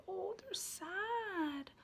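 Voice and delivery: very slow, soft and sorrowful; gentle mock sad. Shown as a demonstration. Parent teaching emotions, in a soft mock-sad voice. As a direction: show baby what sad feels like. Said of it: "you say".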